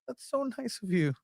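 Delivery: high, soft "aw" voice; touched cooing. Real, not acted. Cohost hears they would be in the documentary, touched cooing. Mock-touched reply to a compliment.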